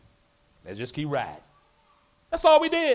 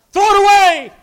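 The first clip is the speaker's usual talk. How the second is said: \"away\" thrown out louder and higher; emphatic preaching; 7 semitones higher, 8 dB louder than usual. Shown as a demonstration. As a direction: hammer the command home. Verse repeated, second time louder.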